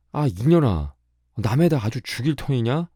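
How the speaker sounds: high-pitched indignant holler; acted furious rebuke, swooping high; barked scolding. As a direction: holler at her to stop. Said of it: "다시 꽥 호령을 하니까".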